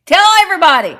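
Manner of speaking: loud, high mock holler; joking shout. Host kidding with a viewer, joking shout. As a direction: holler it out as a joke.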